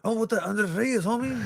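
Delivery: hammed-up celebrity impression, pitched high; Danny Trejo impression. Actor asks coach about a player, high-pitched mock impression. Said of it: "There's Danny Trejo interviewing fake Mikey Varas".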